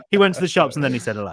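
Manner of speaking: rattled off quickly, no rhyme rhythm; just read it straight and quick; fast plain reading of he went to the shops. Shown as a demonstration. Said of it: "That's what I meant to say".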